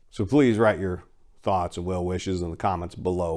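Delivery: ordinary delivery, nothing marked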